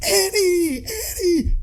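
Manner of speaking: very high, pestering cartoon squeal; annoying Jar Jar. Jar Jar pestering Darth Vader, annoying squeal. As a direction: imitate a pest calling out in falsetto. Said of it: "Jar Jar is just annoyingly just like".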